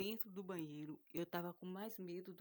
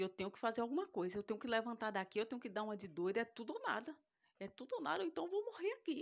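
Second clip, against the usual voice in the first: fast, climbing higher toward a panicked peak; building panic; 4 semitones higher, 4 dB louder, faster than usual. Retold or imitated. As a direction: talk yourself into bolting, rising fear. Panicked inner thought, rising. (pânico crescente, cada vez mais agudo)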